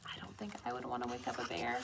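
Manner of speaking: fast whispered aside. Real, not acted. Teacher whispering an aside to the children.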